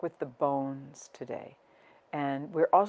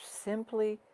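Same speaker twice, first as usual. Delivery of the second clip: guided meditation. Real, not acted. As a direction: guide them gently inward, unhurried, letting each phrase settle. Leads a breathing meditation.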